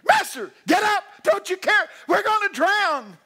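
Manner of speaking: high, loud terrified yell; panicked screaming. Retold or imitated. Disciples screaming at sleeping Jesus, terrified and loud. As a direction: scream for help over the storm. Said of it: "They start screaming".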